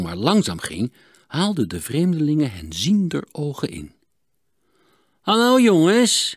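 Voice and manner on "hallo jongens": langgerekte, hoge en luide begroeting; roepend. A narrator's reading. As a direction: roep traag over het water. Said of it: "riepen ze". (luid, gerekt geroep)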